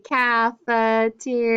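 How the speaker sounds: slow "Cafeteria" in five separate beats; syllable by syllable. Shown as a demonstration. Teacher counting syllables, slow "Cafeteria" syllable by syllable. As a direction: sound out "Cafeteria" slowly as ca, fe, te, ri, a. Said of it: "Let's see".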